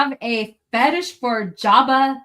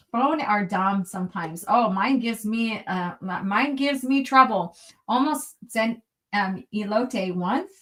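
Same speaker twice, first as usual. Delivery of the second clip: normal talking voice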